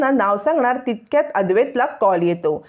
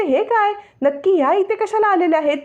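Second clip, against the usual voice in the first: high, alarmed annoyance; startled and irritated thought; annoyed surprise; 8 semitones higher than usual. Acted. Naina annoyed, thinking. (उंच, चिडलेलं आश्चर्य)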